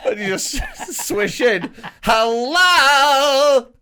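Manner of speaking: high, loud, showy mock greeting; drawn-out, hammed-up political pundit entrance; Owen Jones. Mocking Owen Jones swishing in to talk socialism, hammed-up impression. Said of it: "Such a good Owen Jones impression".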